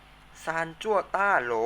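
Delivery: ซานจั่วต้าโหล said slowly and loud, syllable by syllable; slow loud teacher model of ซานจั่วต้าโหล. Shown as a demonstration. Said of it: "ออกเสียงตามนะครับ".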